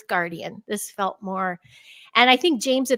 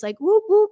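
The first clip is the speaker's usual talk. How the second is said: high whooping noise, much higher and louder; high whoop calls heard at night; imitated whooping sounds; 10 semitones higher, 5 dB louder than usual. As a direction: mimic the little night calls. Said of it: "I could hear this".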